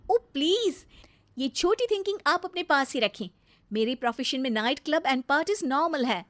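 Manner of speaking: high-pitched, haughty brush-off; dismissive bahu. Acted. Model bahu defends night clubs to mother-in-law, dismissive. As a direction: wave off the nagging.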